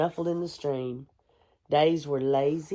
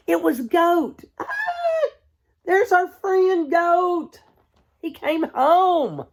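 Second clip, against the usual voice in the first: high, loud burst of joy; joyful shout; 14 semitones higher, 7 dB louder, slower than usual. A narrator's reading. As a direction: cry it out with delight. Joyful reveal of returning friend.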